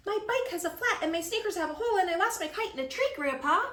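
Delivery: put-on high, fast, upset kid voice; high complaining child; whiny child. A narrator's reading. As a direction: rush the complaint to grandpa.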